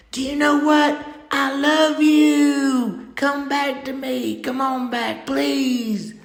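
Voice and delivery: high, loud, slow loving call; yelling good things. Pretend. Calling a distant lover back, high loud loving shout. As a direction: shout your love to pull them back. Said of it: "you should yell at them good things".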